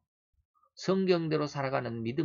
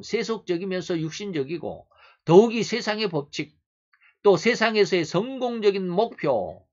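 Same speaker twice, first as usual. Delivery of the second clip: raised, insistent pulpit voice; forceful preaching; 5 semitones higher, 9 dB louder than usual. Real, not acted. Preacher denouncing worldly pastors to the congregation, forceful preaching. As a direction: hammer out the charges phrase by phrase.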